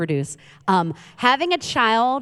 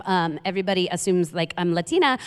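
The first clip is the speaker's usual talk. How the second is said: ordinary delivery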